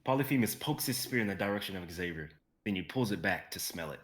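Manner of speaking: usual speaking voice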